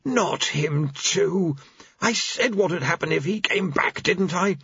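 disgusted. Acted. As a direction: grumble like you warned them all. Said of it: "waving her hand in disgust".

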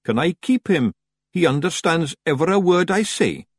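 sheepish tiny Scots pixie; Feegle voice. A narrator's reading. Feegle pleading.

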